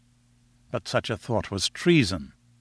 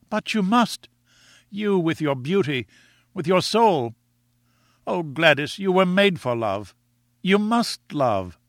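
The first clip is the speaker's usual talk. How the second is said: much higher, earnest plea; Ned insisting to Gladys that it's love; Ned, ardent lover; 5 semitones higher than usual. A narrator's reading. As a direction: pour out the words as a smitten young man. Ned urges Gladys passionately.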